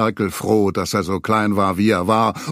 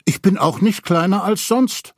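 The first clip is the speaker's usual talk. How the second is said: Piglet's voice; 8 semitones higher than usual. A narrator's reading. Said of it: "antwortete Ferkel".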